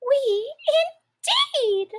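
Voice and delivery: high indignant squeak; trembling mouse cry. A narrator's reading. The offended mouse cries at Alice. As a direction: squeak out the outrage. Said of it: "cried the mouse".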